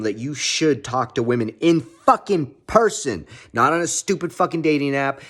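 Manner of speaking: ordinary delivery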